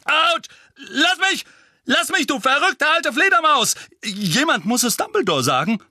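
high, loud cries of pain and alarm; panicked, indignant yelling; yelping protest. Acted. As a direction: shout as if fending off blows. Mundungus yelps while being beaten.

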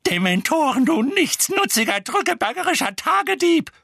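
high, loud tirade; furious scolding shriek; screaming. A narrator's reading. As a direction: hurl insults in a shrill scream. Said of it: "schrie Misses Figg".

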